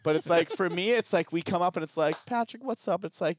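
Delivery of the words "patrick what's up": much higher, eager hello to a buddy; excited greeting. Retold or imitated. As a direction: greet your friend with real joy. Acted happy greeting to friend.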